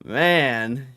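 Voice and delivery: ordinary delivery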